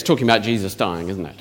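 ordinary talk

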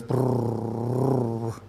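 low rolling purr; cat purr. Shown as a demonstration. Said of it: "I don't know if I can make it with the microphone".

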